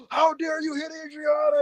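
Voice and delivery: high, hammed-up indignant cry; mock outraged Tony. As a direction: overact chivalrous outrage. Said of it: "and Tony's like".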